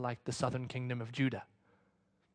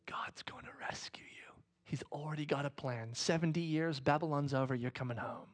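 hushed, softer prophecy; whisper; 6 dB quieter than usual. Acted. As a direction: breathe the promise quietly. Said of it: "Jeremiah is saying".

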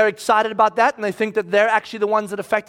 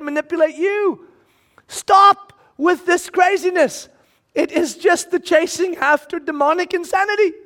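high, building, forceful preaching; impassioned rebuke; 5 semitones higher than usual. Acted. Paul telling the crowd to stop, impassioned rebuke. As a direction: urge them hard to quit.

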